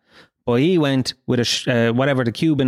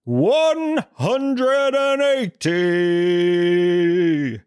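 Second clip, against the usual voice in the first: high, long-drawn score call; darts referee voice; 4 semitones higher, much slower than usual. Acted. Darts referee calling the score before doubting life, silly drawn-out voice. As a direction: announce it like a darts ref, stretching every word. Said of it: "a darts referee has ever gone".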